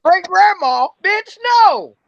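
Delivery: loud, high-pitched outraged no; indignant refusal. Retold or imitated.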